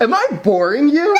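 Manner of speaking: high, laughing mock hurt; laughing mock offense. Subject yawns mid-interview.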